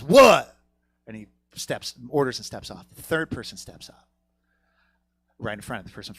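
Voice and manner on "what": one loud, abrupt demand; acted brusque greeting to a customer; blunt counter worker. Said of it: "It's very blunt".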